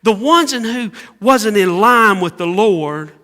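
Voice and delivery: high, loud preaching climax; preaching at full force. Acted. Preacher voicing the panicking disciples to the church.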